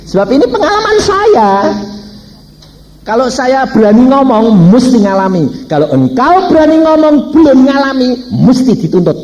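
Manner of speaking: loud, high, insistent sermon delivery; full-force preaching. Real, not acted. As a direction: drive the conviction home at the top of your voice.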